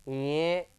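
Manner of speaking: ये as a held nasal nya; nasal pronunciation. Shown as a demonstration. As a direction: model ये as the palatal nasal, nya. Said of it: "इसको क्या बोलेंगे?". (ये को नाक से "ञ" जैसा खींचकर बोलें)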